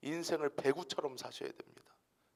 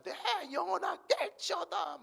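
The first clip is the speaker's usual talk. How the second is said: high, strained cry of King David; high psalm cry to the soul. Retold or imitated. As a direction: call your soul awake like David. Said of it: "부르짖고 외치잖아요".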